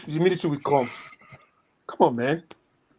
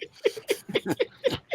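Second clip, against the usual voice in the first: high breathy laugh; 5 dB quieter than usual. Real, not acted. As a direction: burst into a real laugh. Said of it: "[name] has started laughing now".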